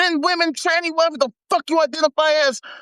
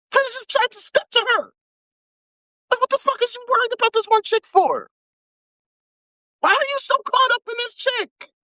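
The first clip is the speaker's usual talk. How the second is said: raised-pitch exasperated questions; incredulous, pitched up, asking why he cares; exasperated disbelief; 9 semitones higher than usual. Real, not acted. Exasperated at uncle.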